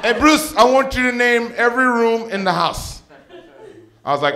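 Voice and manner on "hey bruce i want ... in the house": high, loud imitation of a tipsy mom; heckling mom. Mom calls to her son walking away, high loud pestering. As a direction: call after him in a loud, high voice. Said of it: "She goes".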